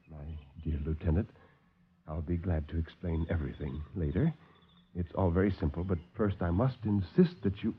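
low, soft, smooth villain; calm the visitors like a silky host; suave doctor. Acted. Doctor soothes the alarmed officers, low and soft.

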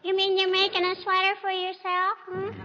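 put-on high, sweet child's voice, sing-song; little girl voice. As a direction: play a cheeky little girl. [name] plays little girl Teeny.

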